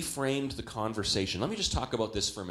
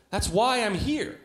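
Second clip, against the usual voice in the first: exasperated patient; 8 semitones higher, 4 dB louder, slower than usual. Retold or imitated. Hospital patient protesting.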